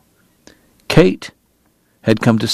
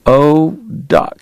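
ordinary delivery, nothing marked

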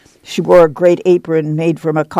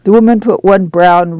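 plain reading voice

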